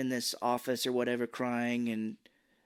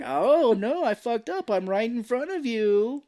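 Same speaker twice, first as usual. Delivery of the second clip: high, loud, drawn-out pretend panic; hammed-up fake blunder; mock dismay; 9 semitones higher, 6 dB louder, slower than usual. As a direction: wail it as obvious bait. Plays pilot pretending to blunder.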